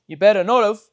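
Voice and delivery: loud menacing warning; threatening stranger. Retold or imitated. Stranger warns the lads off, loud and menacing.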